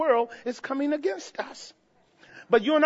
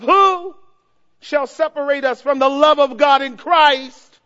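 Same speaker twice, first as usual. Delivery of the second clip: proclaimed quote; 3 semitones higher, 7 dB louder than usual. Acted. Preacher proclaiming Paul's words to the congregation, loud and ringing. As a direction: cry out the verse to the church. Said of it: "Listen to what he says in verse thirty-five".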